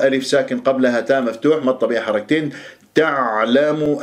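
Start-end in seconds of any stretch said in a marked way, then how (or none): 2.9-4.0 تعلم chanted slowly, ع squeezed mid-throat; held ayn. Shown as a demonstration. Teacher models the word, held ayn. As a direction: let the sound run through the ayn in تعلم. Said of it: "العين الساكنة تحتاج".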